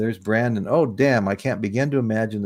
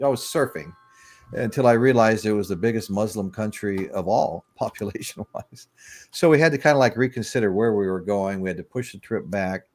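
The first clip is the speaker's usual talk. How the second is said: ordinary talk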